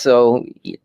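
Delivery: ordinary talk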